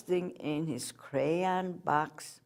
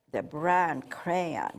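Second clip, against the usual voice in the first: usual speaking voice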